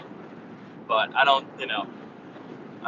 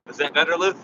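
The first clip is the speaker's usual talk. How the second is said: ordinary delivery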